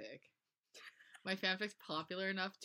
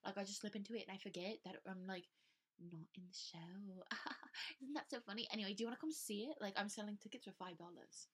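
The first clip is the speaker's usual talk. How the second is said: soft, raised, affected chatter; 3 semitones higher, 6 dB quieter than usual. Acted. Theater kid selling tickets to the show, soft affected voice. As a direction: prattle softly like a self-important theater kid. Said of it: "like, the stereotypical theater kid thing".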